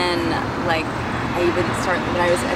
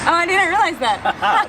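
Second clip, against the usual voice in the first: surprised; 11 semitones higher, 4 dB louder, slower than usual. Real, not acted. Learns recording already started.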